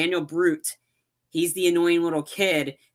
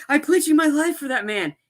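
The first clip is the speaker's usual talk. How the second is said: mock outraged protest; 11 semitones higher, 4 dB louder than usual. Retelling partner's protest.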